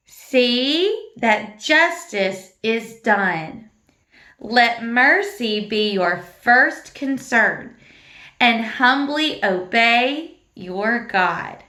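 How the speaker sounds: slow, drawn-out verse chant; slow recitation. Shown as a demonstration. Teacher leading children through the whole verse slowly. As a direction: recite it slowly together with the class. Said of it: "so let's try it again".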